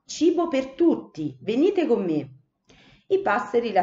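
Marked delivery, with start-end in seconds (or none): 0.1-2.3 breathless excitement. Acted. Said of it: "arrivò trafelata".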